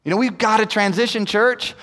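usual speaking voice

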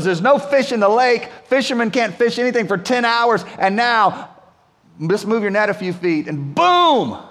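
ordinary delivery

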